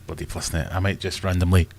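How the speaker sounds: ordinary delivery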